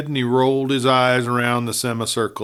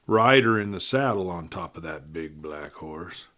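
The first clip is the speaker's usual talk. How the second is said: ordinary delivery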